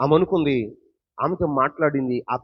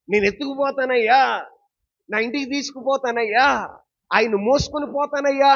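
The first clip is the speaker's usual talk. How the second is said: loud pleading; 8 semitones higher, 4 dB louder than usual. Acted. Mary's plea preached loudly.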